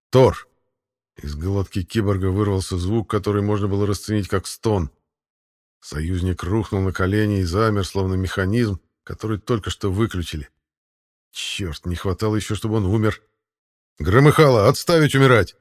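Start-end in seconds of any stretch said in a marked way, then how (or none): 0.1-0.5 sharp command word. Acted. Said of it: "быстро произнёс". 14.0-15.5 a loud, higher order; raised voice. Acted. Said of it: "Чуть повысил я голос".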